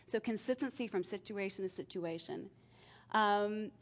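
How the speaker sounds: ordinary delivery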